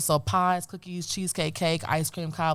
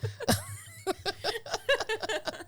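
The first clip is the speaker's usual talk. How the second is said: high, drawn-out laugh; 5 dB quieter than usual. Real, not acted.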